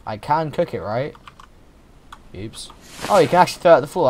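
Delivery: ordinary delivery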